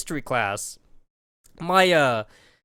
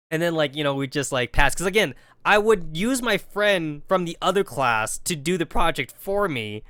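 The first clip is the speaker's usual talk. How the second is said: ordinary talk, nothing marked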